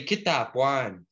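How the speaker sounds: very slow Kitapuan; model pronunciation. Shown as a demonstration. Teacher modelling a Cree word for learners, very slow. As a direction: spell Kitapuan out slowly in sound. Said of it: "Try say".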